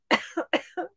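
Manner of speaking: real coughing fit; dry cough from dust. Real, not acted. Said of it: "when you have a coughing fit".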